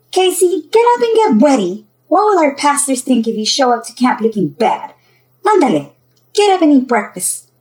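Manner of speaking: loud, high-pitched nagging mother; scolding. Acted. Mom hurrying Casey up for camp, scolding. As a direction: scold loudly to get a sleeper moving.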